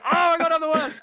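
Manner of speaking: high, loud mock wail; exaggerated self-pity cry; dramatic despair. After the bracelet bursts over the sofa, a loud high mock wail.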